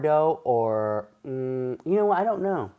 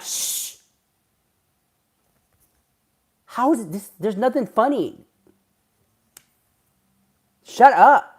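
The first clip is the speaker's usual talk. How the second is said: Karen; 9 semitones higher than usual. Retold or imitated. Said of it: "I'm a Karen".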